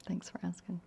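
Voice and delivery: a real unvoiced whisper of thanks; whispered, almost to herself. Real, not acted. Closing thanks to questioner.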